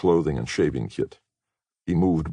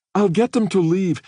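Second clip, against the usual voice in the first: put-on much higher, louder pleading girl; 5 dB louder than usual. A narrator's reading. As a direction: Katie begging her friends to stay. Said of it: "she was pleading".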